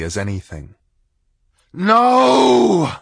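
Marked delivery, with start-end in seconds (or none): 1.7-3.0 inhuman high scream. A narrator's reading. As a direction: scream it from behind a door. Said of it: "screamed a voice from beyond the door".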